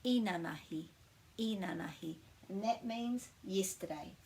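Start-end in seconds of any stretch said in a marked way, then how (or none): 0.0-2.2 inanahi with the weight on the opening I; first-syllable stress. Shown as a demonstration. Teacher repeating inanahi with first-syllable stress. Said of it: "we put the emphasis on the first syllable".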